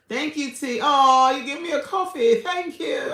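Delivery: high, loud overjoyed thanks; gushing thanks. Real, not acted. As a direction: squeal thanks to a donor. Thanking viewer for coffee donation.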